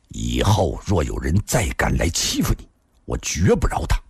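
low, restrained anger; gritted-teeth vow. A narrator's reading. Afei promising to protect her, grimly angry. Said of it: "咬着牙说道".

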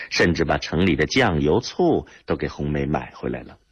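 ordinary delivery

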